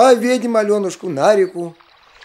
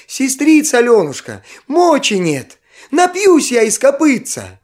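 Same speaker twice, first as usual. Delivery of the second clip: put-on higher, louder desperate child's plea; desperate little boy; 6 semitones higher, 5 dB louder than usual. A narrator's reading. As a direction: beg as if you can't bear it. Brother can't bear thirst.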